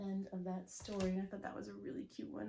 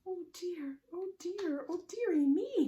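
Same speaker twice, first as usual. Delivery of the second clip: high-pitched fretting little witch; worried cry; 11 semitones higher, 8 dB louder than usual. Acted. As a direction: fret in rising loudness, oh dear. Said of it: "cries a worried little witch".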